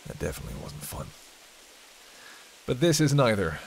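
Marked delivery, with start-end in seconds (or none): 0.1-1.1 whispered aside. Acted. Dark aside about the torture basement.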